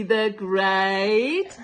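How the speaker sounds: ordinary delivery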